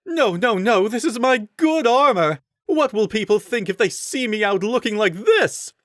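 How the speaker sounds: high, loud vain wail; whiny rider. A narrator's reading. Vain rider in the mud, high loud wailing. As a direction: wail over ruined armor. Rider dismayed at muddy armor.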